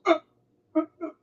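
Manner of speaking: high, strained mock death groans; dying groans. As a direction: choke out a dying man's groans. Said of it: "It goes".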